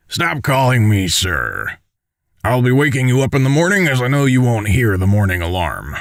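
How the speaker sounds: put-on commander voice, easing off the formality; Dex, informal commander. Commander tells soldier to drop the sir, informal commander voice. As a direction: play the superior who waves away rank.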